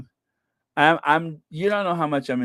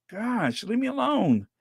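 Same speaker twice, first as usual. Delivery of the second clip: mock exasperation; 6 semitones higher than usual. Mock complaint at spam comments.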